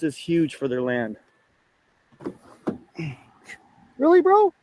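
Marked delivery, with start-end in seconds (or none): 4.0-4.5 incredulous. Real, not acted. Mock disbelief at someone.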